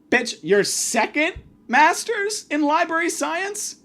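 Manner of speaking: high, drawn-out disbelief; incredulous. Acted. Reacting to the librarian's second master's, incredulous.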